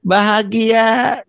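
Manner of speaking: giddy in love. Retold or imitated. Acting giddy lovers.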